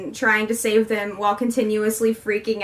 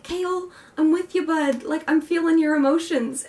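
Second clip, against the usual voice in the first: higher, swooning sympathy; gushing fangirl; 5 semitones higher than usual. Retold or imitated. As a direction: coo at him like a friend. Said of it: "I was like".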